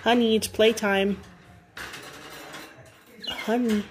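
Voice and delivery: playful call to a pet; pet talk. Real, not acted. Coaxing pet bird to play.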